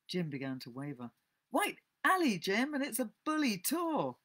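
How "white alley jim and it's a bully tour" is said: high, eager sales pitch; tempting boy Tom. A narrator's reading. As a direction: dangle the prize in a high boy's voice. Tom tempts Jim with marble.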